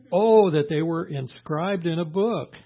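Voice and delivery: loud, slow, impassioned scripture reading; crying out. Retold or imitated. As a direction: cry it out from a full chest, drawn out. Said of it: "Job cries out and says".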